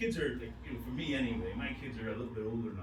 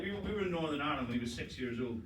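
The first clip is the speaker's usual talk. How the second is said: normal talking voice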